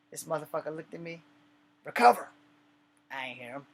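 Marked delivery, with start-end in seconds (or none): 1.8-2.3 loud, high barked command; drill sergeant yell. Retold or imitated. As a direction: roar the order across the yard. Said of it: "When he rose his voice".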